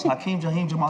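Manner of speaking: acted caller. Caller introduces himself to young Darius, acted caller. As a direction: state the name as the caller. Caller gives his name on phone.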